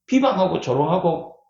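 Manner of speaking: ordinary talk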